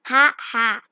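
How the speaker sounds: loud scornful ha ha; dragon's mocking laugh. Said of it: "laughed Smaug".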